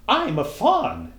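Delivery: put-on a young fawn crying out in delight. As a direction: burst out with glee at being free. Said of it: "it cried out in a voice of delight".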